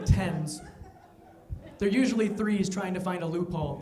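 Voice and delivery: normal talking voice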